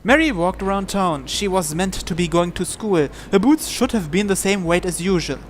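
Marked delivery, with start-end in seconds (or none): none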